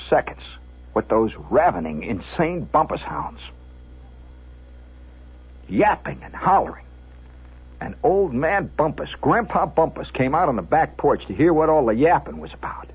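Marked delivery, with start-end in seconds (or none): none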